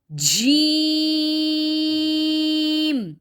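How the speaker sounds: جیم pressed hard and held very long; a long, forceful جیم; pressed jeem. Shown as a demonstration. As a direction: lean hard into جیم and stretch it out. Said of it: "یہ بہت زیادہ دبا کے پڑھنا ہے".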